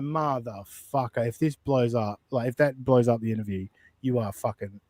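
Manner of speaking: low, soft retold cursing under the breath; muttered exasperation. Host retells cursing a guest over a risky email, muttered exasperation. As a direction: grumble it quietly to yourself.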